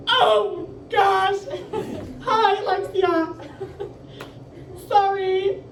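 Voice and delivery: flustered high voice. Retold or imitated. Teen answering crush's beach call, high flustered laugh. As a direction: squeak it out embarrassed. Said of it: "And I was like".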